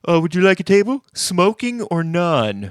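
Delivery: high, loud restaurant hostess; sing-song waiter asking smoking preference; chirpy waitress voice. Retold or imitated. Waitress offering a table.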